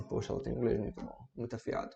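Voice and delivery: quietly impressed, as if commenting aside. Retold or imitated. Listener remarking on someone's good English, impressed aside. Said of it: "vão falar assim".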